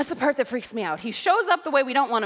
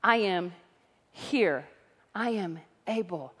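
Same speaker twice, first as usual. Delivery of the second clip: preaching as God, building; 3 semitones higher than usual. Acted. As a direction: build from a hush to a ringing proclamation.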